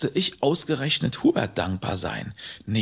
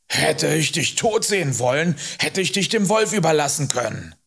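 higher, emphatic scolding; Hubert, indignant rider; 5 semitones higher, 3 dB louder than usual. Acted. Rider rebuts boy's accusation, indignantly. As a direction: set the child straight indignantly.

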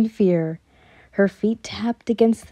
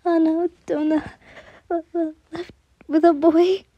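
high, tearful, halting voice; terrified confession; 7 semitones higher than usual. A narrator's reading. Frightened servant telling her mistress the daughter left, high and tearful. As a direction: dread breaking the bad news.

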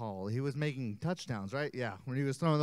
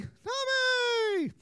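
high called name. Shown as a demonstration. Cueing kids to shout name.

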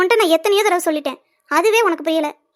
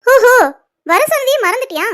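higher, sarcastic repeat of his words; mocking echo; 5 semitones higher, 6 dB louder than usual. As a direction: throw his excuse back at him. Tara mockingly echoes his excuse.

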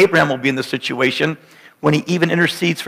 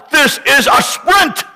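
high, slow shout on sprint; shouted climax; 11 semitones higher, slower than usual. Acted. Preacher driving home Aaron's run, slow rising shout.

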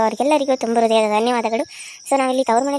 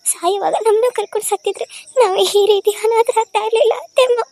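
high, weepy begging that climbs higher at the end; tearful pleading; 6 semitones higher than usual. Acted. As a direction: plead at her feet, near sobbing. Orphan girl begging mother-in-law. (ಅತ್ತೆಯ ಕಾಲಿಗೆ ಬಿದ್ದು ಅಳುತ್ತಾ ಬೇಡುವ ಧ್ವನಿ)

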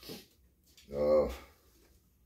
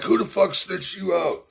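much higher mock angry voice; imitating Brian; 13 semitones higher than usual. Brian calling him up pissed, higher mock angry voice. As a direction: squeak out an angry demand. Brian angrily calls about Facebook.

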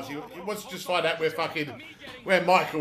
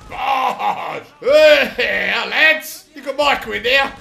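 loud, higher taunting shout; 6 semitones higher, 12 dB louder, much slower than usual. Real, not acted. Player taunting the enemy gang in the shootout, loud shout. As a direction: yell at the gang.

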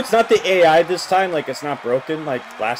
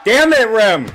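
loud frustrated yell at Rem, higher; angry shout; 7 semitones higher, 8 dB louder, slower than usual. Real, not acted. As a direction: vent at the character on screen. Frustrated gamer yells at wrestler.

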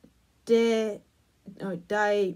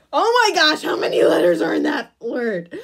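much louder amazed exclaim; shocked outburst; 7 semitones higher, 9 dB louder than usual. Real, not acted. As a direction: blurt it out in disbelief. Shocked by another long word.

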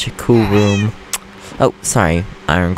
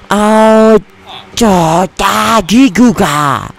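high, loud frustrated babbling; angry gibberish; 9 semitones higher, 5 dB louder, much slower than usual. Real, not acted. Player venting after creeper explosion, furious babble. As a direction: sputter nonsense syllables in rage. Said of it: "That's the sound of me really pissed".

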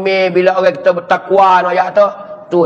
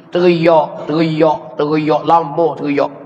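ordinary talk

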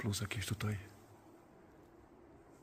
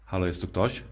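calling out; 6 dB louder than usual. Real, not acted. Calling out in empty church.

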